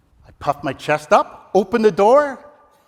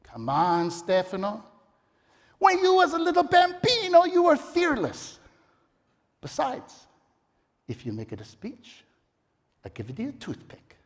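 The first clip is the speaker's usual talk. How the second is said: put-on Italian mama accent; 8 semitones higher than usual. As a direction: tease him gently like an Italian mama. Mama coaxing son.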